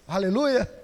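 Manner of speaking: ordinary talk, nothing marked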